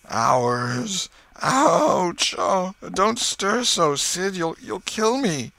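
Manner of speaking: high, drawn-out wail of agony; melodramatic, slow moaning; groaning in pain. Acted. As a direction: cry out as if the slightest touch hurts. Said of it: "his groans had gathered quite a genuine tone".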